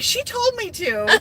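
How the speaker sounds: high, slow whine; whiny childish voice. Retold or imitated. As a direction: whine it like a kid blaming someone. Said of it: "And I'm like".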